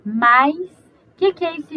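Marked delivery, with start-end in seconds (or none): 0.1-0.6 Mais dito mais alto, com o ditongo bem audível; pronúncia demonstrada. Shown as a demonstration. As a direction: articule Mais lentamente para a transcrição. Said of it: "a transcrição fonética fica assim". (demonstração clara de Mais)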